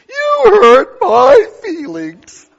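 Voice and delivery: mock a hurt baby, slow and crying; whiny mock victim. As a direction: pretend sob of hurt pride. Mocking a wounded complainer.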